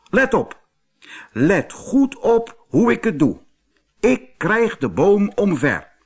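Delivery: high, loud show-off bull; bragging bull. Acted. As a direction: boast before the charge. Bull showing off.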